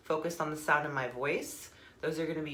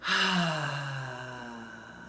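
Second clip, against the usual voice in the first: long, voiced "ahh" on the out-breath; cleansing sigh; 2 semitones lower than usual. Shown as a demonstration. Leading listeners in cleansing breaths, long audible sigh. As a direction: exhale audibly, letting it fall away. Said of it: "like I'm doing, feel free to make noise".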